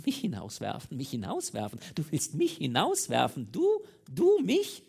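incredulous repeats climbing to a high, loud peak; stammering disbelief. A narrator's reading. Mistress threatened with being thrown out, stammering outrage. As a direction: stumble over it, then explode on the last words. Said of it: "Sie stammelte".

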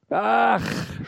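high, loud outcry; crying out. A narrator's reading. Intruder stung by the maid's threat, sharp outcry. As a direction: cry out as if stung. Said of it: "schrie der Mensch auf".

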